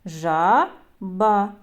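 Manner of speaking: "Жаба" split into Жа, ба with a pause; syllable by syllable. Shown as a demonstration. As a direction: count out the two syllables of "Жаба". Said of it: "Скільки складів у цьому слові?".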